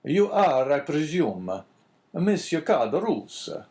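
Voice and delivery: put-on strong Italian accent. A narrator's reading. Priest questioning the innkeeper, strong Italian accent. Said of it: "speaking with a strong Italian accent".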